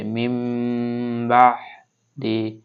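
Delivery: মিম বাহদি with ন turned to a held nasal ম; iqlab with meem. Shown as a demonstration. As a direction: hum the meem before বা. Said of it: "মিম ধরে পড়তে হবে". (ন সাকিন বাদ দিয়ে মিম ধরে গুন্নাহ)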